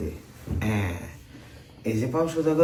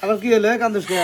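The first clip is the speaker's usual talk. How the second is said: raised, high, startled questioning; exasperated demand; 7 semitones higher, 4 dB louder, faster than usual. Acted. Husband to wailing wife, loud and exasperated. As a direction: demand to know what the crying is about. Man confronts suddenly wailing woman.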